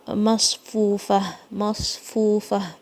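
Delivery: مصفوفہ very slow, heavy unshaken ص and long و; heavy saad. Shown as a demonstration. Teacher drills مصفوفہ very slowly, heavy saad. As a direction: drag out مصفوفہ with a full, steady ص. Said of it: "صوت کو بھاری پڑھنا ہے".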